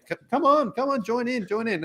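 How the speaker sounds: high, bright coaxing call to join in; eager Italian family invite. Retold or imitated. Family coaxing the backpacker to join, high and eager. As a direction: coax the guest over the match, pitch way up.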